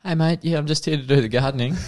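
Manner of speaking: high, fast hammed-up Aussie greeting; Aussie bloke. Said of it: "And he's just like".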